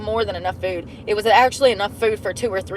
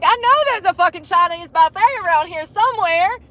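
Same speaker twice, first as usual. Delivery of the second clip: higher-pitched insistence; mock stubborn self; 8 semitones higher, 4 dB louder than usual. Vlogger imitating own stubborn hungry self, higher. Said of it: "I'm like".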